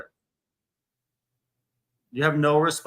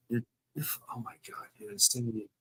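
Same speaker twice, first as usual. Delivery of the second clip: real, quiet exasperation under the breath; exasperated aside; 2 semitones lower, 11 dB quieter than usual. Real, not acted. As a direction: let it drop almost to nothing. Exasperated insult during real argument.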